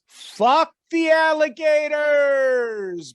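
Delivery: high, loud, drawn-out battle cry; full-voiced reckless yell, very slow; retold yell. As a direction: holler it defiantly, stretching the last word. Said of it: "he yells".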